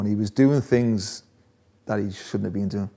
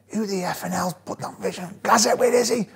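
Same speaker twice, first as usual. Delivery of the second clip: mock outrage; 8 semitones higher than usual. Kit man hunting whoever hid the fish, high mock outrage. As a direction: imitate an outraged man, pitched right up.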